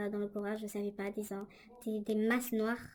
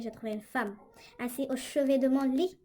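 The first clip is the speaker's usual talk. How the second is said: ordinary talk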